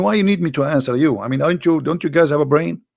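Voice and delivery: ordinary talk, nothing marked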